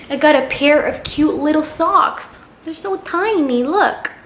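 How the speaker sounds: a lighter high coo at tiny things; cute gushing. Real, not acted. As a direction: coo over the little socks. Gushing over tiny socks.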